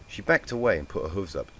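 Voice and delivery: usual speaking voice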